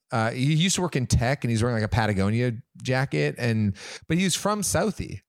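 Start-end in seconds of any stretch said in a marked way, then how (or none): none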